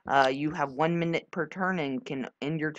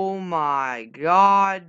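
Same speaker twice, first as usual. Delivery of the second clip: slow, louder oh my god; drawn-out oh my god; 7 dB louder, much slower than usual. Real, not acted. Player realising the answer was easy, slow disbelief. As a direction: stretch it in disbelief.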